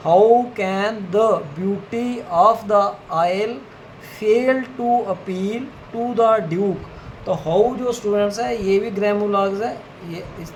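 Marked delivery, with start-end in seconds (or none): 0.1-7.0 each word spaced out, very slow, for students writing along; slow dictation. Shown as a demonstration. Teacher dictates a sentence to shorthand students, very slowly. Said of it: "fifth जो sentence है".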